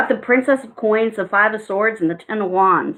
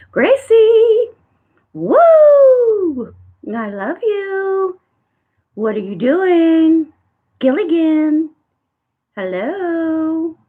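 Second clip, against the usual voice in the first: high, drawn-out cooing calls; excited calling; 7 semitones higher, 4 dB louder, much slower than usual. Real, not acted. Host greets Gracie and Gilligan, excited calling. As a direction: call out to beloved birds, stretching each word.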